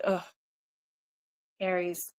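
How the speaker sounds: usual speaking voice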